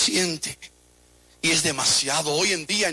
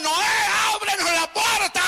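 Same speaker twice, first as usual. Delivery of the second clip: pleading shout; 13 semitones higher than usual. Retold or imitated. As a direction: beg Noah to open the door. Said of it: "Y ellos gritaban". (súplica gritada y aguda)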